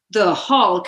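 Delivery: usual speaking voice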